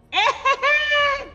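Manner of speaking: very high, bright burst of laughter. Real, not acted. Reader laughing after finishing her poem, high laugh. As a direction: let out a high squeal of a laugh.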